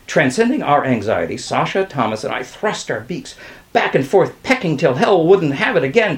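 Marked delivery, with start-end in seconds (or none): none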